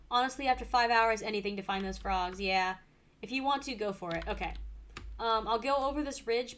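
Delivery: normal talking voice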